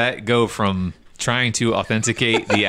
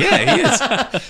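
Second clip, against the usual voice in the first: emphatic agreement; 3 dB louder than usual. Retold or imitated. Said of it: "I'm like".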